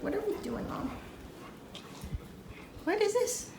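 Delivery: high, puzzled dog voice; dog's voice. Retold or imitated. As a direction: voice the confused dog. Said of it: "It's like".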